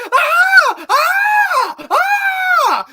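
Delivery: hammed-up high scream; mock screaming. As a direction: scream like a terrified actor overdoing it. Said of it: "And he's like".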